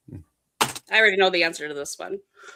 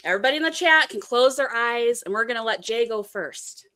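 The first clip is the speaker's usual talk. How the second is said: ordinary delivery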